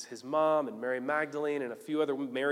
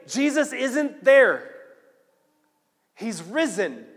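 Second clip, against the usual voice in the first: high, loud breaking news; urgent announcement; 7 semitones higher, 8 dB louder, slower than usual. Acted. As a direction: proclaim it to everyone, drawn out at first. Women run back with the news.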